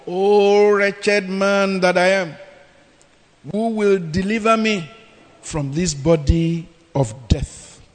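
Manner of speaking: slow, lamenting read of wretched man; preacher voicing Paul's lament; scripture lament. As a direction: let the cry of despair drag out. Reads Paul's lament slowly.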